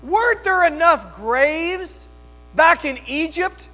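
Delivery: high, loud, desperate cries at Moses; rising to a full shout on leave us alone; panicked people yelling. Acted. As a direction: wail it out as a terrified crowd blaming their leader. Preacher voicing panicked Israelites.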